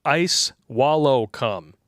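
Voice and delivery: "Ice Wallow Cum" slowly, one word at a time; "Ice Wallow Cum" drawn out, each word separate; deliberate pronunciation. Shown as a demonstration. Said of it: "name is pronounced".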